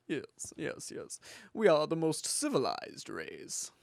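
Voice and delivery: hammed-up accent of the superior Westerner; mock superior accent. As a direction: play it smug in a cheesy fake accent. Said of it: "that's the wrong accent. It's more like".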